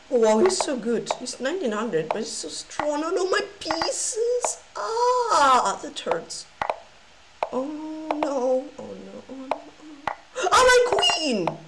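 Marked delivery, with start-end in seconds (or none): 3.0-6.5 pained whimper. Real, not acted. Losing pieces. 7.5-10.1 much softer repeated oh no; despairing murmur. Real, not acted. Collapsing position. 10.5-11.5 high, tearful cry over the queen. Real, not acted. Queen lost, cry heard.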